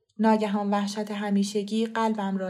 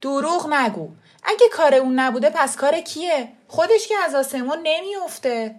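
loud, higher, fed-up father; louder impatient demand; 6 semitones higher, 7 dB louder than usual. A narrator's reading. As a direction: snap at the family, out of patience.

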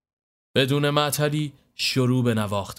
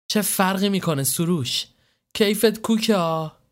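put-on higher, brighter girl's voice, playful jab; teasing young woman; 8 semitones higher than usual. The girl teasing Soroush about his good mood. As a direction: tease him lightly as the girl.

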